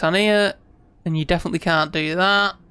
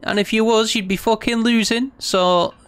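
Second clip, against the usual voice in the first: mock soothing; 6 semitones higher than usual. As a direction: talk yourself down like calming a child. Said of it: "I'm spazzing out a little bit. I need to, to take a breath".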